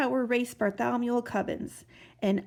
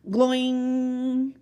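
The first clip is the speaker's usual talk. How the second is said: springy gloing; 3 semitones higher, 5 dB louder than usual. Acted. Sound of oobleck snapping.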